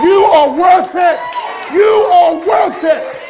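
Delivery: preacher shouts the refrain to the congregation; preaching shout. Real, not acted. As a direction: shout it out to the crowd.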